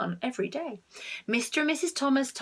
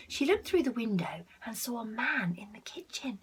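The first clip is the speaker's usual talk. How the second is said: suspenseful whisper; 8 dB quieter than usual. A narrator's reading. Building suspense as the burglar appears, hushed whisper. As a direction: draw the child in with a secret.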